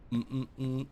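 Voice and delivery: ordinary talk, nothing marked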